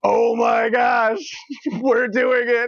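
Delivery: high, slow, laughing disbelief; giddy, half-laughing thrill; excited. Retold or imitated. Streamer retelling his thrill as the clerk signs up, laughing.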